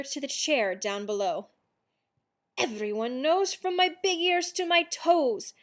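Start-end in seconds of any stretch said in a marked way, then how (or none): 2.6-5.5 higher, defiant kitten's proclamation; proud declaration. A narrator's reading. Kitten insisting he is a chihuahua, proud declaration. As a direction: declare it like a cocky kitten, pitched up.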